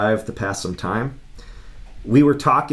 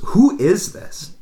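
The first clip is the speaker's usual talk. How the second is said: slow, higher-pitched imitation of a puzzled friend; weirded-out demand; 8 semitones higher, slower than usual. Friend not recognizing the caller on the phone, bewildered. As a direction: draw out the question, retold bewilderment. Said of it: "weird the way she said it".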